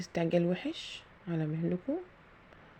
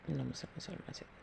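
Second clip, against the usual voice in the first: quick prayer murmured under the breath; muttered prayer; 3 semitones lower, 13 dB quieter, faster than usual. Real, not acted. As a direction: rattle off the blessing softly to yourself. Prayer muttered mid-task.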